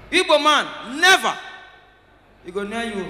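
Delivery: ordinary talk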